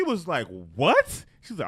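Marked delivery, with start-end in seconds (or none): 0.8-1.3 loud, high-pitched disbelief; shocked retort. Retold or imitated. Coworker hears mother wants out, retold as loud shocked disbelief. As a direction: imitate a stunned, incredulous "what". Retells father's shocked reply.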